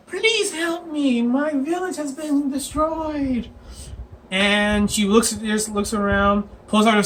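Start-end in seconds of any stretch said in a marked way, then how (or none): 0.1-3.5 high, slow mock child wail; drawn-out, put-on little-kid plea; kid pleading. Kid begs the barbarian to help, mock pleading. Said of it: "and the kid goes".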